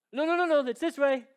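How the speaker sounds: high, loud flustered no no no; father urging Jesus to keep walking; anxious hurry. Retold or imitated. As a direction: hurry him along, half panicked. Jairus hurrying Jesus along.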